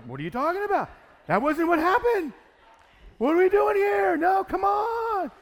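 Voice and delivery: loud, high, heated shouting; raising my voice. Retold or imitated. Arguing with the other team's manager, loud and heated. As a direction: yell back in disbelief. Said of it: "I started raising my voice".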